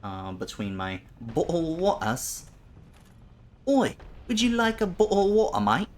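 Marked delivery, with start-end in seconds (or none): 1.2-2.5 mock British accent; bad British accent. Shown as a demonstration. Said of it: "I have some of the worst British accents ever". 3.7-5.9 loud, very high mock British accent; British accent sliding toward Australian, shouted out; bad British accent. Shown as a demonstration. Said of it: "less British and more Australian than anything".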